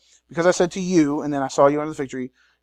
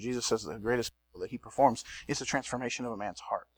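ordinary talk

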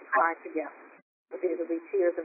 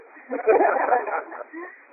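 light laugh; 11 semitones higher, 5 dB louder than usual. Real, not acted.

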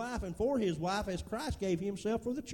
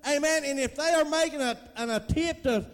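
preaching at full force; 4 semitones higher, 5 dB louder than usual. Acted. Pastor urging the church to forgive, preaching at full force. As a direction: hammer the point home to the church.

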